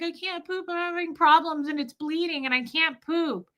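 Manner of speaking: put-on high, whimpering child voice; pitiful high-pitched pleading; mock bawling. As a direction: imitate a tearful kid explaining. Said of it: "and I'm bawling".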